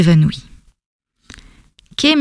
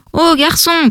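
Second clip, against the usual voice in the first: higher call across the water; 7 semitones higher, slower than usual. A narrator's reading. Fisherman hails Kay from the boat, calling out. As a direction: hail the boy from the boat. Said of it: "cria l'un des hommes".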